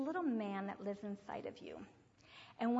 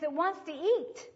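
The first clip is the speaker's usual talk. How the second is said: loud, higher imitation of a boy; urgent demand; 6 semitones higher, 11 dB louder than usual. Boy telling teacher what his little man wants, loud and urgent. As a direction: push it out loudly, leaning on "eat".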